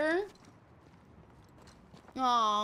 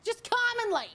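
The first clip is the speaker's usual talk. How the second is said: New Yorker; 7 semitones higher than usual. Acted. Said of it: "It's some New Yorker being out there".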